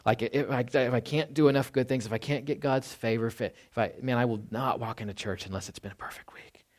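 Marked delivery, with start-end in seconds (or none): 4.0-6.7 unvoiced whisper, talking to oneself; a believer whispering his own harsh rule; whispered inner thought. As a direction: whisper it like a private vow. Voicing a self-condemning thought.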